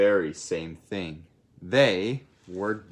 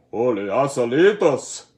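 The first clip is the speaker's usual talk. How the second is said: louder, in a big monster voice; dinosaur roar; 6 dB louder than usual. Acted. T-Mexiquito smelling Scapito, booming monster roar. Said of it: "roared the T-Mexiquito".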